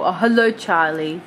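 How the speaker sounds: ordinary talk, nothing marked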